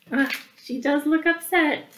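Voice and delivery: ordinary delivery, nothing marked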